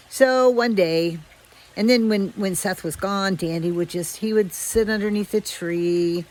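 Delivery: ordinary delivery, nothing marked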